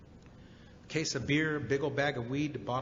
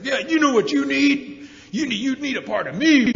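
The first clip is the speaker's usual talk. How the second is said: grandpa challenging; 8 semitones higher, 9 dB louder than usual. Retold or imitated. Grandpa squares up to grandson, loud drunken taunt. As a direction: goad him like a drunk grandpa itching for a fight.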